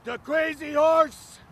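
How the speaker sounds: ordinary talk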